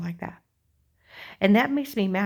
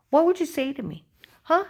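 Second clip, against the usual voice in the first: indignant challenge; 6 semitones higher, 4 dB louder than usual. Real, not acted. Ranting at imagined accusers.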